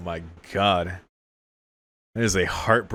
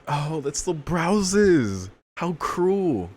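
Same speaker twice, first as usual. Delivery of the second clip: mock dramatic dismay; 9 semitones higher, much slower than usual. Mocking the Browses again.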